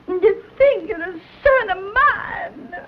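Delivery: high, tearful outburst; crying shout. Acted. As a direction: cry it out at the disowned son. Mother scolds son.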